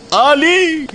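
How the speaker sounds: high bright cry of delight; excited. Acted. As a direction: shout it out, thrilled. Exclamation, very high.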